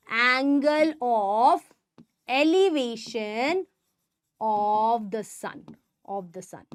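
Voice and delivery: "Angle of elevation of the sun" said very slowly, word by word; slow spelled-out term. Shown as a demonstration. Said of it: "this is said to be angle of elevation".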